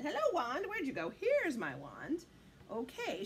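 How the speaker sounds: usual speaking voice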